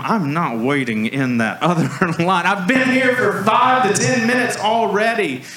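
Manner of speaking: polite on top, fed up underneath; tense half-laughing refusal to the clerks; strained politeness. Retold or imitated. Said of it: "as nice as I can be".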